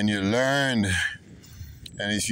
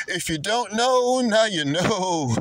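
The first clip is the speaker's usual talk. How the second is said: high, sing-song rap line quote; playful hip-hop quote, pitched up; 9 semitones higher than usual. Retold or imitated. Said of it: "Shout out to [name]".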